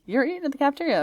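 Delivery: fast and high, correcting herself; high fast correction. Shown as a demonstration. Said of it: "But no, it's like".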